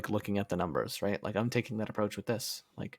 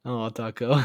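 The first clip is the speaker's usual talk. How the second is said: ordinary talk, nothing marked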